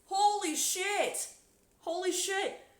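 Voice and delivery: high, loud shocked shriek; shocked shout. Real, not acted. Streamer shrieks at the guard's story, loud and high. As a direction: scream it out in disbelief.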